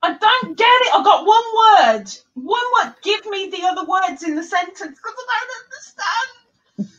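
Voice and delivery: high, hammed-up pleading confusion; baffled listener. Acting a listener lost in fast dialect, hammed-up despair. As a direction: plead loudly, dragging it out, fading into a whine. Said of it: "And you're like".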